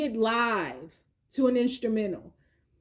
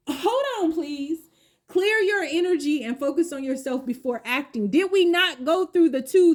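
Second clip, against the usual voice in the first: higher and louder, suddenly animated; excited realisation; 4 semitones higher, 4 dB louder than usual. Real, not acted. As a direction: catch the card's message with a jolt.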